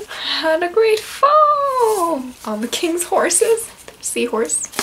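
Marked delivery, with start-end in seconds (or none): 0.1-3.7 high, slow, sing-song rhyme reading; nursery rhyme reading. Acted. Reading the Humpty Dumpty rhyme to kids, slow and sing-song. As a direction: chant it like an old rhyme.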